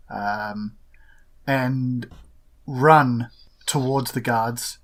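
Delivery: normal talking voice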